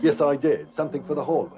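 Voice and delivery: put-on American faking an upper-class English accent; put-on posh English accent. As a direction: play the English lord in a plummy English accent. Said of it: "You'll never get away with that accent".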